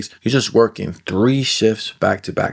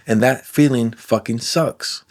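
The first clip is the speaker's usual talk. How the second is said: ordinary talk, nothing marked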